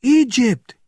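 very high, loud outcry; shrieked one-word alarm; screamed. A narrator's reading. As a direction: scream it in panic. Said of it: "he screamed".

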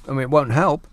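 ordinary talk

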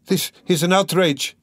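outraged cry. A narrator's reading. Dunya confronting the man who locked her in, crying out. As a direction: protest in outrage. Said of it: "cried Dunya".

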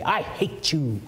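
lightly voiced spiteful declaration; a hint of petty spite; mock hateful. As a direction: say it like a sulky child. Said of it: "you go to your father and you say".

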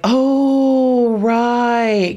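high, drawn-out "oh, right" of sudden recognition; mock dawning realization. Recalling meeting the parents, a high surprised "oh, right". Realizing who the famous dad is.

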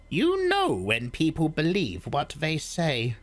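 put-on high, measured old woman's voice; old Reverend Mother. A narrator's reading. Old woman explains the box to Paul, high and measured. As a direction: speak as the stern old crone.

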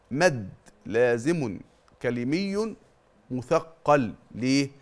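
ordinary delivery

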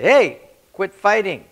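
put-on dad yelling at fighting kids, loud and slow. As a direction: call out to the kids to stop.

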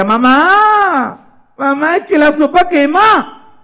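high, drawn-out complaint; acted little girl's protest, slow and high; whining child. María complaining to mamá about hot soup, high whine. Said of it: "Y María decía".

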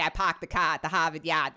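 put-on stock Boston accent with dropped r's; hammy Boston catchphrase. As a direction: attempt a broad Boston accent. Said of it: "trying to emulate the Boston one".

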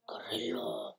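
unvoiced whispered word. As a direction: breathe it as a whisper. Whispered repeat.

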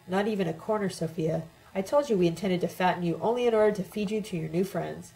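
ordinary delivery

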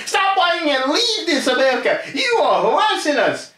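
high, laughing mock voice of the other side; squeaky, giggling parody of a heckler; mocking taunt. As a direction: sneer it out in a silly high voice while laughing. Mocking imitation of opponents' taunts.

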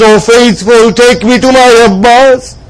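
ordinary delivery

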